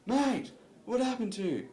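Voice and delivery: calling out, pitched high; mock yell. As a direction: yell it with concern. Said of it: "he does it again".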